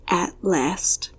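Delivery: barely breathed, almost no voice; soundless whisper. A narrator's reading. Prince silently mouthing to Faye, a near-soundless whisper. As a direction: mouth the words with only breath. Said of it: "His lips moved, and though no sound issued".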